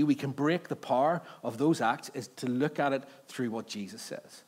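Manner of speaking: normal talking voice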